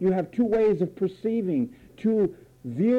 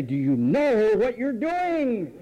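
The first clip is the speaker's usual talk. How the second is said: slow, higher scolding question; slow mock-scolding question; 4 semitones higher, slower than usual. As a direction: lean in and scold slowly. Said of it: "talk to you and say".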